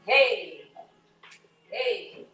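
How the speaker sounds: loud call across the house. Real, not acted. Cook calling to the household for mayo, loud call. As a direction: call out to someone in another room.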